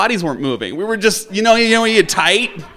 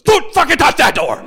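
loud, higher furious yell to keep him back; angry yell; 9 semitones higher, 5 dB louder than usual. Retold or imitated. Big domino player yelling at the intruder by the door, furious shout. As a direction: bark it out like a threat.

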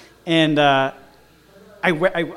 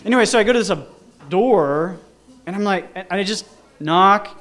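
normal talking voice

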